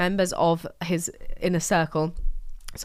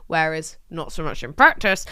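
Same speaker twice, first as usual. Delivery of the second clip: ordinary talk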